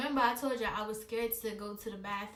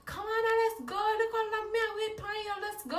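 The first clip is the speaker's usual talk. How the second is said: mom calling her; 10 semitones higher, faster than usual. Retold or imitated. Mother calling her daughter to leave, mock impatient high voice.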